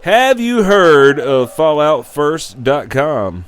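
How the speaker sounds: ordinary talk, nothing marked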